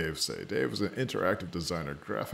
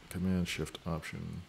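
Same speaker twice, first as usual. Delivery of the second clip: ordinary delivery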